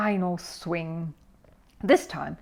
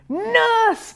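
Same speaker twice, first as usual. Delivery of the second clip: high, loud angry call; bellow; 4 dB louder than usual. A narrator's reading. Mister Curry calling for the nurse after falling, bellowing. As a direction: bellow across the ward. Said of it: "bellowed Mister Curry".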